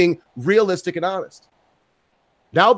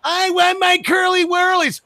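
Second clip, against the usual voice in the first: high-pitched mock kid's wail; tantrum child; 12 semitones higher than usual. Acts a child's supermarket tantrum.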